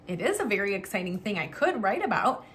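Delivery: ordinary talk, nothing marked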